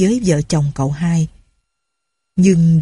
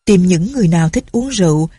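usual speaking voice

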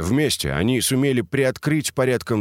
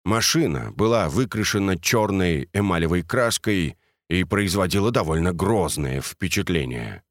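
ordinary delivery, nothing marked

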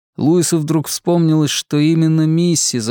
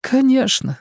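tearful woman voice; 13 semitones higher than usual. Acted. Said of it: "ответила она".

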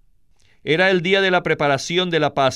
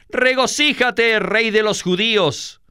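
mock hailing; 6 semitones higher than usual. A narrator's reading. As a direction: hail a mock king, pitched up. Soldiers mockingly hail the king. (saludo burlón de los soldados)

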